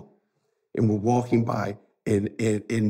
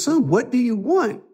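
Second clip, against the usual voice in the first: higher-pitched, pressing retold question; insistent; 11 semitones higher than usual. Uncle repeating his question to crying nephew, insistent. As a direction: imitate an uncle pressing for an answer.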